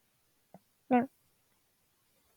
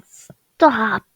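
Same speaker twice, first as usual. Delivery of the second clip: hammed-up offended protest, a bit loud; mock offended; 2 semitones higher, 5 dB louder than usual. Voicing the drawn character being offended, mock-offended cry. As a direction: play the offended character, pushed louder. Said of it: "Him being offended is like".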